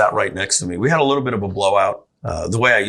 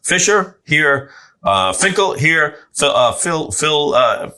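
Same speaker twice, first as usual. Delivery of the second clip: higher and louder, slow as if reading a roll; teacher calling roll; 7 semitones higher, 4 dB louder, slower than usual. Retold or imitated. Teacher taking roll on the first day, higher and slow. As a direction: read each name out like a teacher stumbling. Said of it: "It would always be".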